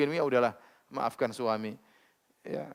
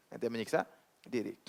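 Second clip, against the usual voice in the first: quieter echo, weight on "menyiksa"; softer repeat; 5 semitones lower, 10 dB quieter than usual. Shown as a demonstration. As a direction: drop the voice and lean on "menyiksa".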